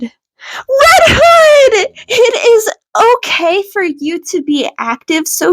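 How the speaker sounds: high, loud surprised call; high excited cry of his name; surprised greeting. A narrator's reading. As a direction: greet him with startled delight. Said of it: "Izuku greeted back and asked".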